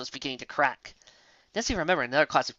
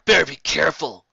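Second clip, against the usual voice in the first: growled warning; 7 dB louder than usual. A narrator's reading. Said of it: "Geller growled".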